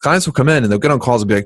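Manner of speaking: normal talking voice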